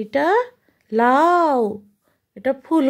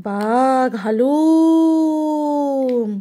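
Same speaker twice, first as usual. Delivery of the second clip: very slow playful haalum; tiger roar; much slower than usual. Shown as a demonstration. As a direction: growl it out very slowly like a tiger. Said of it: "বাঘ কেমন করে ডাকে?". (খুব ধীরে বাঘের হালুম)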